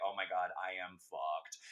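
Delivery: low, deflated retold realization; imitated sinking realization. Host retells the patient's self-realization moment, low mock dismay. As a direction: let it drop low, as if it just sank in. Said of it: "And then she's literally like".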